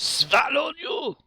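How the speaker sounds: shocked high shout on swallowed; surprised. Pretend. Chat asks for a surprised face, streamer yelps high. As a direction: yelp it in mock shock, high and loud. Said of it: "Surprised face".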